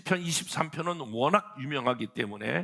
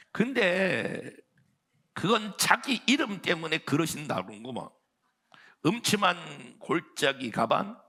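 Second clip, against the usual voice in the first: higher, lilting Pyeongan dialect reading; 6 semitones higher, 4 dB louder than usual. Pretend. Preacher reading Psalm 23 to the congregation in Pyeongan dialect, playful. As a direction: ham up a northern Pyeongan psalm. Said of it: "평안도 버전 있습니다".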